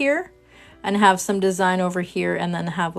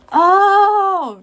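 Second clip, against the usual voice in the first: loud high gasp of recognition; sudden happy realization; a sharp high oh of getting it; surprised oh; 11 semitones higher, 6 dB louder than usual. Retold or imitated. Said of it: "I was like".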